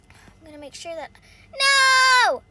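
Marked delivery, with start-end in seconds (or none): 1.5-2.4 high, loud drawn-out cry; alarmed shout. Real, not acted. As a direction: yell in dismay. Setback in the game.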